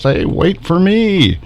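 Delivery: lightly voiced small figure calling after the others; left-behind child. Voicing a figure left behind in distress, mild high plea.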